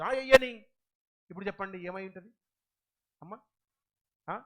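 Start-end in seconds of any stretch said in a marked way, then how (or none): 0.0-0.6 mock dismissive order. King's men voiced.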